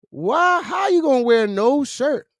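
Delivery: shouting questions at the shirtless thief; mock scolding shout. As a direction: yell at him like a fed-up cop. Scolding a shoplifter on video.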